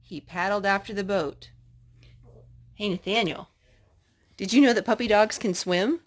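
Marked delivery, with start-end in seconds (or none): none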